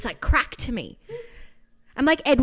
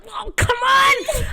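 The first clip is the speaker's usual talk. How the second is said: loud, high, drawn-out mock whine; mock-exasperated drawn-out cry; exasperated outburst; 12 semitones higher, 4 dB louder, much slower than usual. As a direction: act out bursting with impatience. Impatient reaction to friend's story.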